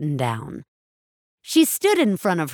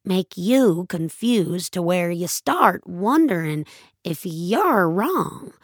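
ordinary delivery

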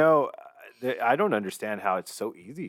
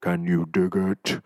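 Shaq impression; 6 semitones higher, 5 dB louder, slower than usual. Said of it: "I do have a little bit of a Shaq impression".

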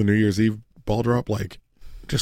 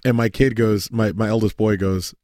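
ordinary delivery

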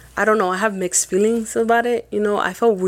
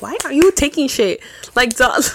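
high, exasperated mock scold; incredulous scold; 6 semitones higher, 3 dB louder than usual. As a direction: scold him in disbelief, pitched up. Scolding partner for taking card.

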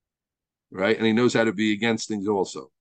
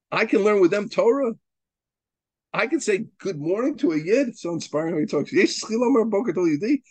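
pitched-up preaching voice; fervent preaching; 6 semitones higher than usual. Acted. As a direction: lift it and preach it out.

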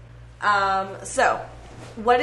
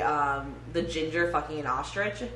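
usual speaking voice

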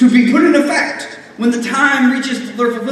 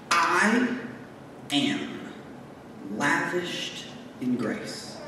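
hushed affirmation; 5 semitones lower, 12 dB quieter, slower than usual. Acted. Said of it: "hushed self-affirmation".

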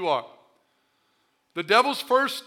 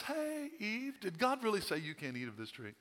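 much softer, sly hushed voice; sly tempter; 14 dB quieter than usual. Acted. Satan tempting Eve in the garden, sly and hushed. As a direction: lure her in quietly.

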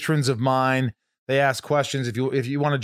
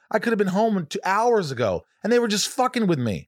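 ordinary talk, nothing marked

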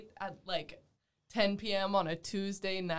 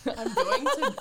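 usual speaking voice

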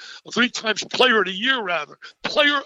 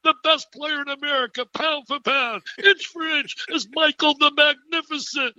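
higher-pitched retold courtside yell; raised, excited mock announcer yell; 7 semitones higher than usual. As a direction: ham up the excited shout again, pitched high. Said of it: "screaming and yelling supposedly".

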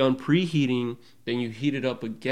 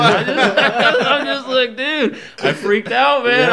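laughing; 13 semitones higher, 8 dB louder, slower than usual. Real, not acted. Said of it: "laughs through retelling".

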